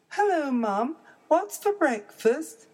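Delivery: high little cub voice; eager small child bear; baby bear voice. Acted.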